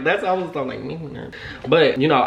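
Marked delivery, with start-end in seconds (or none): 0.8-1.4 nervous murmur. Retold or imitated. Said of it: "I didn't say that".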